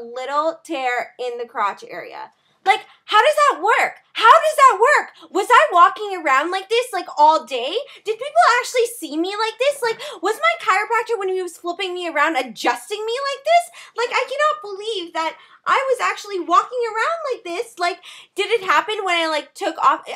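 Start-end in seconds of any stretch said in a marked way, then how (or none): 2.6-5.3 loud, high, disbelieving cry; how could this even happen, said loud; rising outraged disbelief; incredulous outburst. Real, not acted. Shocked at ripped jeans. 5.3-20.0 high, agitated disbelief; freaking out. Real, not acted. That's my sort of freak out.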